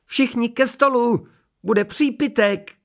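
very high, drawn-out woman's call. A narrator's reading. Mrs. Majerová calling everyone from the dining room, high-pitched call. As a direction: sing out high to summon the guests. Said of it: "Z jídelny se ozvala paní Majerová".

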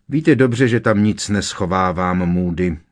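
ordinary delivery, nothing marked

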